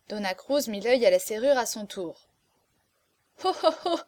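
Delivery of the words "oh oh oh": high acted "oh, oh, oh" laugh; bursting out laughing. A narrator's reading. Girl at the keyhole sees the steward's plight, bursting out laughing. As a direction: exclaim it in high, amused bursts. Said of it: "s'écria-t-elle en éclatant de rire".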